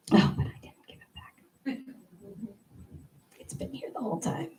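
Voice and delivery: unvoiced whispered confession. Real, not acted. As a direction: whisper the secret to the crowd. Confessing secret to audience.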